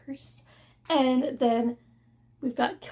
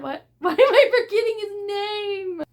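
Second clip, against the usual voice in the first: higher, louder, laughing through the question; laughing frustration; 8 semitones higher, 6 dB louder than usual. Real, not acted. Scolding herself for a brain fart, laughing and higher. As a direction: laugh at yourself while asking why. Laughs at her own forgetting.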